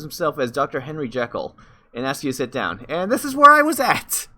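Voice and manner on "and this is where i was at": very high, loud, drawn-out outburst; exasperated exclamation. Real, not acted. Player reaching where the game crashed, exasperated. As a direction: shoot up high and stretch it loudly.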